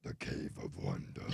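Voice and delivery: deep rumbling cave voice; low stony growl of the Cave of Wonders; grinding stone statue. Retold or imitated. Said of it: "the movie Aladdin with Robin Williams".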